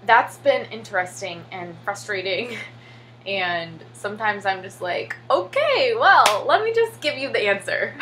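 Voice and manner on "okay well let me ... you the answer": higher, louder, laughing through it; teacher laughing as she hands over the answer; laughing give-up. Retold or imitated. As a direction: surrender with a laugh. Giving up, laughing.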